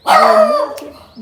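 high, loud squeal of the name; excited shout. Real, not acted. Telling the dog Bunni has come, loud excited squeal. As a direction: announce Bunni's arrival with a thrilled cry.

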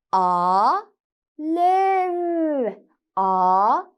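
drawn out, rising on each syllable; sounding out words. Shown as a demonstration. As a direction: stretch each sound before blending. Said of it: "Birleştiriyoruz".

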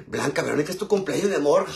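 high, loud delighted gasp and greeting; excited birthday greeting. Real, not acted. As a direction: gush over her birthday. (saludo emocionado y agudo)

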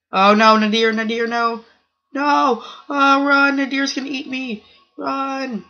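frightened shouts as a big player gives chase; panicked shouting. Real, not acted. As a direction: yell in mock panic to flee.

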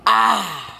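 startled yell. Real, not acted. Sudden death in game.

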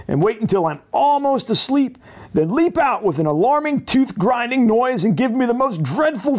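put-on a nervous boy imagining a monster at the letterbox; fretful Jack voice. Lightly voiced anxious Jumpy Jack listing his fears.

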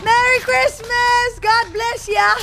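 high, slow, loud holiday shout; loud drawn-out cheer. Real, not acted. Streamer cheering a holiday greeting to chat, loud and drawn out. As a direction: stretch it out like a big festive cheer.

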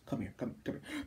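very soft, hushed beckoning; barely voiced, luring the victim closer; menacing beckoning. Robot beckoning before it hits Shinji, hushed menace.

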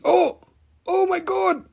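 very high, squealed astonishment; shocked outcry. Acted. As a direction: squeal it out in high-pitched disbelief. Mock shock at spotting a bubble.

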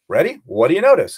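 ordinary talk, nothing marked

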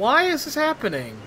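high-pitched plea of dismay; high, distressed complaint; exasperated wail. Real, not acted. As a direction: wail it in frustration. Despairing at game crash.

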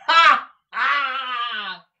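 one huge high "Ha!"; loud, long-held guffaw; loud, sonorous laugh. A narrator's reading. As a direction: burst out with a booming laugh. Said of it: "a laugh composed of one loud, sonorous".

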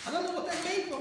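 ordinary talk, nothing marked

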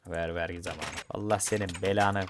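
usual speaking voice

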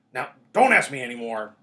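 loud, gruff Hagrid. A narrator's reading. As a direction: cut off the questions gruffly and loudly. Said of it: "said Hagrid gruffly".

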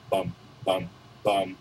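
low, soft doom notes; ominous hum. Acted. Teasing bad news in the letter, low ominous hum. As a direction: hum a sinister bum bum bum. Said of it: "the dramatic pause".

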